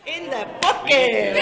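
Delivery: loud, high, laughing cheer; triumphant shout. Real, not acted. Speaker celebrating that nobody guessed her age, triumphant shout. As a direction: crow it out, laughter bursting through.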